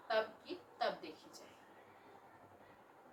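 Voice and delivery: slow, half-whispered brush-off; dismissive mutter. Acted. Daughter brushing off mother's warning, slow dismissive mutter. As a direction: shrug it away under your breath.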